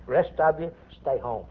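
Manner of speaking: ordinary talk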